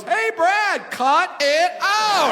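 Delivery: high, loud, drawn-out call; retold scolding call. Someone telling Brad to stop without missing a beat, scolding yell. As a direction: yell it out, stretching the last words.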